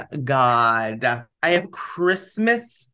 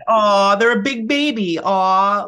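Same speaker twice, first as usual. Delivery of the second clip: higher, drawn-out mock aww; mock cooing; 4 semitones higher, 4 dB louder, slower than usual. Podcaster pretending to find baby talk adorable, mock cooing. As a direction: fake being charmed by baby talk. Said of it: "I've never had someone do baby talk".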